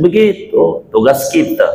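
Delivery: ordinary delivery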